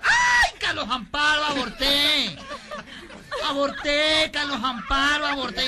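high, loud, hammed-up wail; shouting. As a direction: cry out in campy melodramatic panic. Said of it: "comenzó a gritar". (gritando, agudo y melodramático)

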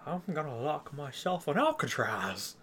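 slow, lightly voiced Piper imitation; a hint of Roddy Piper; Piper impression. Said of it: "Piper impersonation".

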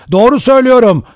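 high, loud insistent protest; shouting. A narrator's reading. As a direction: shout it, desperate to be believed. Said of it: "diye bağırdı Rogers". (bağırarak, tiz sesle)

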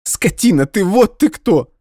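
high, loud furious cry; angry exclamation. Acted. Nadya shouting insults at Dmitry, high furious cry. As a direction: hurl the insult at him. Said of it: "воскликнула Надя".

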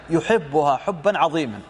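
ordinary talk

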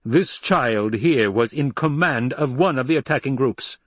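high, loud, imperious harangue; indignant Emperor. Acted.

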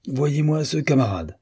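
the admiral appealing to his dog, mildly angry; red-hot anger. A narrator's reading. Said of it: "dans une colère poussée au rouge".

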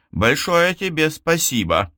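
lightly acted outburst; mild angry retort. A narrator's reading. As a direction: throw the thanks back at him crossly. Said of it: "рявкнула она Фералу".

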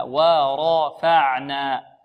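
"ورفعنا" with heavy ra, drawn out; slow model word. Shown as a demonstration. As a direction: stretch "ورفعنا" with full ra. (نطق "ورفعنا" ببطء وتفخيم الراء)

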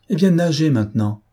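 spring the trick on him with a cry; exclaiming. A narrator's reading. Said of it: "s'écria-t-elle".

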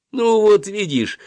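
high, lilting cheery encouragement; cheerful. A narrator's reading. Dentist coaxing her young patient, cheerfully. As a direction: pitch it up, brightly reassuring. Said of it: "весело шептала мне врачиха".

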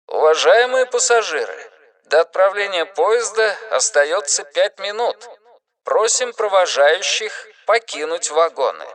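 over the intercom. A narrator's reading. Station announcement over loudspeaker.

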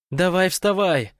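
loud, high, drawn-out urging; triumphant shout. A narrator's reading. Irina to waking Rogalik, shouting triumphantly. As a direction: shout the sleeper awake in triumph. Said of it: "торжествующе крикнула Ирина".

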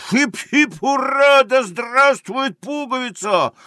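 high, loud hurrah; sailors' cheer. Acted. English sailors cheering the winner, high loud hurrah. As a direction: shout a triumphant high cheer. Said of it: "Матросы-англичане кричат".

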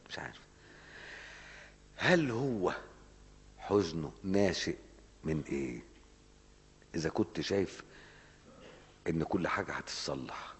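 normal talking voice

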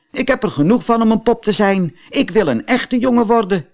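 put-on high, eager puppet boy voice; a wooden puppet boy who wants to be real; Pinocchio's voice. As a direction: Pinocchio pleading with the fairy to become a real boy. Pinocchio speaks to the fairy.

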